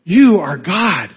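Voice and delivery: a measured, emphatic cry of awe. Acted. Preacher voicing what Peter should have cried out, awed proclamation. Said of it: "cried out".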